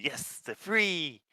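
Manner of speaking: high, drawn-out goblin cry; slow, high-voiced goblin. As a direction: stretch it out like a goblin exulting. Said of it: "goblins saying".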